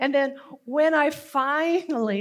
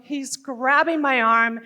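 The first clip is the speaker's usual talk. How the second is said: ordinary delivery, nothing marked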